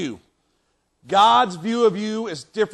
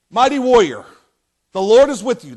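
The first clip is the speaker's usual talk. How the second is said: raised, proclaiming voice of God; calling out; 8 dB louder than usual. Acted. God appearing to Gideon, calling out to him. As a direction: hail him loudly as God would.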